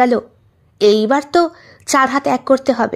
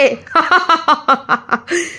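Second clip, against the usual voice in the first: a room breaking into laughter; group laugh at the joke; burst of laughter; 3 semitones higher than usual. Acted. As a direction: let the laugh roll out. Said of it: "বলে একটা হাসির রোল পড়ল".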